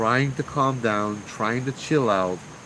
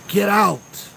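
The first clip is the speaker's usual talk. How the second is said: loud, high commanding yell; spirit-box voice yelling a warning; 10 semitones higher, 6 dB louder than usual. Retold or imitated. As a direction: yell it as an order to flee. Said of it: "you hear a voice yelling".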